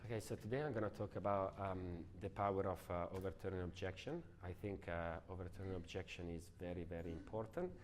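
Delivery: low, quiet, hesitant mumble; dull monotone. Shown as a demonstration. Trainer showing how not to deliver a talk, dull monotone. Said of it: "you speak like this".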